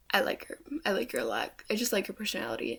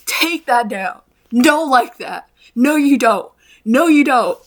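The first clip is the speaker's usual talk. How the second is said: loud, emphatic refusal; crashing out; 4 semitones higher, 13 dB louder, slower than usual. Real, not acted. Crashing out over the liked video, loud and emphatic. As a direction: loudly order it gone. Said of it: "that's me crashing out over that".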